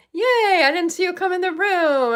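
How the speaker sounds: light up as a friend appears; excited yay. Real, not acted. Host delighted to see a viewer join, excited. Greets a viewer arriving in chat.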